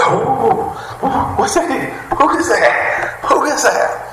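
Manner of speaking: very high, drawn-out squeal of wonder; wildly excited girl. Retold or imitated. Preacher as girl hearing mother's voice, wildly excited. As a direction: imitate a little girl thrilled by a new voice. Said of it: "she going crazy, ain't she?".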